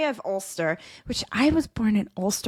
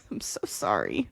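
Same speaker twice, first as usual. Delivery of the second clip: usual speaking voice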